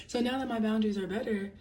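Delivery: ordinary talk, nothing marked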